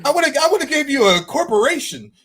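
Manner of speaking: high, loud exasperated disbelief; acted, high-pitched amazement; mock incredulity. Voicing God amused at small requests.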